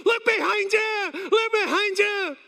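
high, drawn-out mock-panic yell; panicked shout. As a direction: yell a warning at the TV in imitation. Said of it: "you're getting in a panic".

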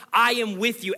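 high, loud, stress on I; loud proclamation. Acted. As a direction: cry it out with conviction. God's promise, first take.

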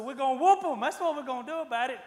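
hammed-up tough guy itching for a brawl; fired-up boast. [name] telling Sean they'll whoop the trespassers, mock fury. Retelling eager fight talk.

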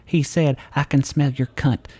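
hint of a Southern woman; Southern accent. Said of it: "with a fucking Southern accent".

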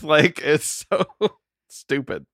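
laughing through the words; giggling helplessly. Real, not acted. Laughing about the show.

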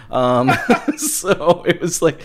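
laughing. Real, not acted. Laughs while telling.

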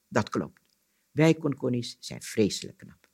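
measured storyteller's reading voice; story narration. A narrator's reading. Storyteller reading an Anansi tale aloud, story narration. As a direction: tell it as a folk tale, pausing between sentences.

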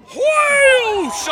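high loud whoosh sound. Pretend. Host voicing the runner speeding by, loud whoosh. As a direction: blast out a rushing wind noise.

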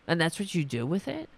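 ordinary delivery

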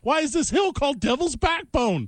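high, eager fan voice; excited viewer. Retold or imitated. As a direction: squeal it like a thrilled fan. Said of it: "And you're like".

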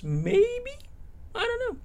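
high, squeaky and hesitant; squeaky unsure voice. Real, not acted. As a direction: pitch way up, playfully unsure. Playful unsure aside after hoping.

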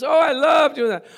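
high, loud burst of enthusiasm; gushing delight. Acted. Preacher relishing a commandment aloud, gushing delight.

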